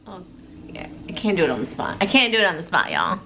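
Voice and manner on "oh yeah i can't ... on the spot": low, fast throwaway aside; embarrassed mutter. Real, not acted. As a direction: mumble it, embarrassed.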